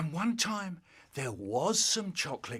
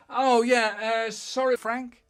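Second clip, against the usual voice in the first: higher and louder, caught out; flustered apology; 8 semitones higher, 9 dB louder than usual. Retold or imitated. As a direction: blurt a sheepish sorry. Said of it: "And I said".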